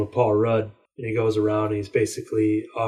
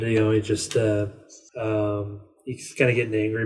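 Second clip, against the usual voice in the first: usual speaking voice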